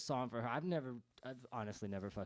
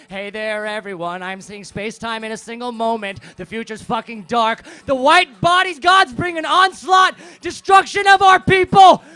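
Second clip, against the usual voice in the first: much louder and higher, shouting doom; screaming prophecy; 9 semitones higher, 25 dB louder than usual. Acted. Singer ranting his peyote vision at the audience, screamed. Song turns to prophetic rant.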